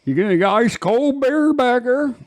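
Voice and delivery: high, drawled mock bar order; country drawl. Asking the bartender for beer, in a high mock country drawl. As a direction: ham up the country twang.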